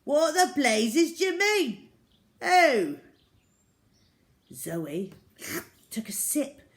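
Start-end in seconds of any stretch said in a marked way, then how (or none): 0.0-3.0 high, loud indignant splutter; outraged landlord. A narrator's reading. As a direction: bluster with outrage.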